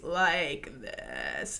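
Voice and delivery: slow, low, creaky fry; vocal fry. Shown as a demonstration. Vocal coach demonstrating vocal fry, slow and creaky. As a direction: let the voice crackle down into fry. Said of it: "what vocal fry is".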